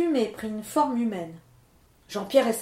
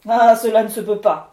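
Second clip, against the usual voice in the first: much louder, indignant spirit voice; angry storm spirit; 10 dB louder than usual. Acted. Levent refusing the miller's lie, loud indignant spirit. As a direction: scoff in a big loud voice.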